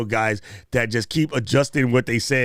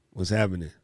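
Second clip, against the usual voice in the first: "What's happening?" lower and softer; softer repeat; 8 semitones lower, 5 dB quieter, faster than usual. Real, not acted. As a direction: echo the greeting quietly.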